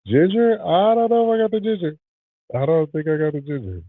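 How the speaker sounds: a high, playful disbelief over having ginger; squeaky high ginger question; high mock surprise. Mock-surprised musing about ginger.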